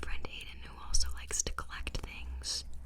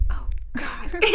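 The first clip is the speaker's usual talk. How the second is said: mic bump; 13 semitones higher, 11 dB louder than usual. Real, not acted. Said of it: "Sorry, I keep hitting the mic".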